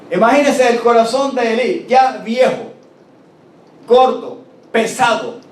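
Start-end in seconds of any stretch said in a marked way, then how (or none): none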